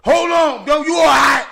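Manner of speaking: high, loud alarmed yell. Retold or imitated. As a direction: shout across to check he's alive. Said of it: "Bird Kennedy's yelling at me".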